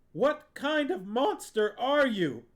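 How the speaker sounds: put-on an enraged boy confronting his aunt; through clenched teeth. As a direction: force it out through gritted teeth. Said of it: "from between clenched teeth".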